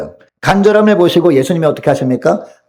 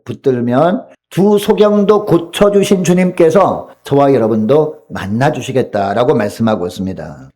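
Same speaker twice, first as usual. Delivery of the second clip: usual speaking voice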